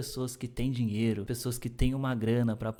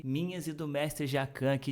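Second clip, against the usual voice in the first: normal talking voice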